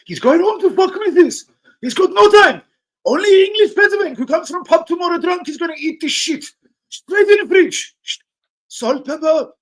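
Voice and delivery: high, loud mock Turkish accent; Turkish kebab worker. Kebab man mocking a drunk English customer, shouted Turkish accent. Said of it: "They're fucking Turkish".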